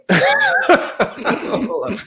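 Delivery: breaking into a high-pitched laugh; high squeaky laugh. Real, not acted. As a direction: giggle through the words at a high pitch. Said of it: "I can kinda hear it".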